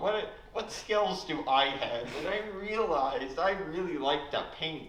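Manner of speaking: hammed-up goofy self-introduction; Cooper Kim impression. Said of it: "I'd like to do my impression of Cooper Kim".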